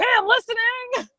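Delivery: high-pitched, playful exasperated surrender; mock giving in. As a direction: give in to a nagging voice, pitch leaping up. Acts answering insistent inner voice.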